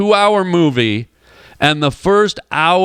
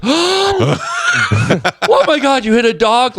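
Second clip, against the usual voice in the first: high, drawn-out mock wail of horror; hammed-up horrified cry; freaked out; 9 semitones higher, much slower than usual. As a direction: imitate a woman losing it in shock. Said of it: "like, freaked out".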